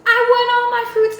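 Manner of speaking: put-on high and loud, childlike want; whiny insistence. Packing fruit in the dream, high loud whine. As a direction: demand it like a kid grabbing snacks.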